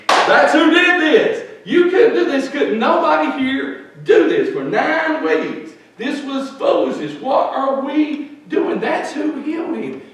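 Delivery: high, drawn-out triumphant shouting; big-mouthed proclamation. Retold or imitated. Believer seizes her chance to tell the atheist doctor, loud proclamation. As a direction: shout the credit to God at full force. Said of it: "and big mouth me".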